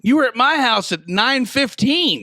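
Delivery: high, emphatic disbelief; incredulous challenge. Real, not acted. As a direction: call him out on it, pitch jumping up. Friend disputes his claimed time.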